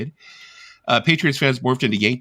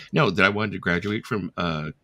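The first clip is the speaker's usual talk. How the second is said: normal talking voice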